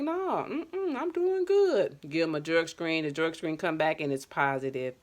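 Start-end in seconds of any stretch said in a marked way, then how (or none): 0.0-2.0 much higher, airy innocent client voice; imitating a client's high, drawn-out innocent denial; fibbing client. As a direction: play the client denying it sweetly. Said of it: "And they be like".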